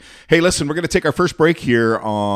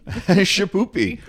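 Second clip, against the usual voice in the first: laughing; 10 semitones higher, much slower than usual. Real, not acted. Laughing, recalls song.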